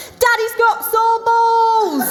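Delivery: put-on high, slow sing-song child's shout. Son in the car park, sing-song gleeful child shout. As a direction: chant it like a gleeful little kid. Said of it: "my son just started shouting".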